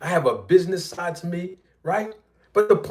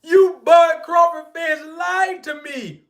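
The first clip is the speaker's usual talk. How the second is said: much higher, slow shouted call-out; hammed-up accusing shout; mock shout; 10 semitones higher, slower than usual. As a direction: yell at the fans, stretched. Calling out fans.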